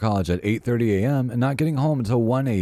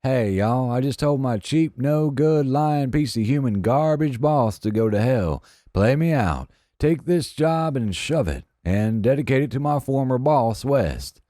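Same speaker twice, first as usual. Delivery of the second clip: higher put-on Southern drawl, hammed-up; fake Southern accent; 5 semitones higher than usual. Prank caller on the radio request line, fake Southern accent. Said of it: "In a fake Southern accent".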